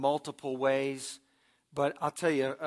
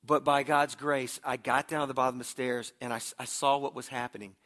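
normal talking voice

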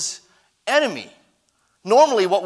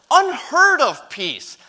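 much higher, loud preaching cry; shout; 9 semitones higher, 4 dB louder, slower than usual. Real, not acted. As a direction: burst out on unheard of peace. Builds from whisper to cry.

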